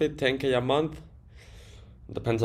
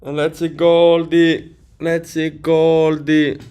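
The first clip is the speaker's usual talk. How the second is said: louder, slow, drawn-out sing-song call of the name Goldie; 4 semitones higher, 8 dB louder, slower than usual. Real, not acted. Streamer hailing viewer Goldie in chat, loud and slow. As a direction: stretch Goldie out like hailing someone across a room.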